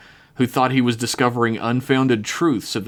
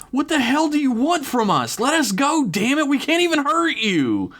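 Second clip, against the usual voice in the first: high furious scream. Acted. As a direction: scream it out in rage. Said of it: "T K screamed out".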